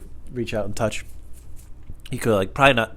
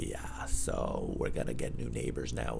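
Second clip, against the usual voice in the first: hushed; 10 semitones lower, 10 dB quieter, slower than usual. Acted. Ominous mock aside.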